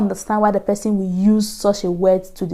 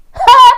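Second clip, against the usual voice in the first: very high, loud "hey"; high-pitched holler of mock alarm; panicked cry; 10 dB louder than usual. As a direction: cry out in exaggerated dismay. Storyteller's panicked cry in retold scene.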